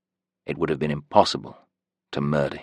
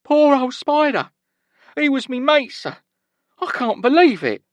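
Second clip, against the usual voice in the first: high-pitched trembling; 5 dB louder than usual. A narrator's reading. Prisoner fakes grief for dead cellmate, high and trembling. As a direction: wail as if heartbroken. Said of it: "high-pitched and trembling".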